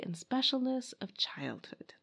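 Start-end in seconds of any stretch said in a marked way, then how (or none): 1.0-1.9 soft breathy whisper on the last words. Acted. Narrator whispering about childhood's beauty. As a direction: breathe it like something precious.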